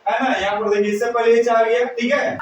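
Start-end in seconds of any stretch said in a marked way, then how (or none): none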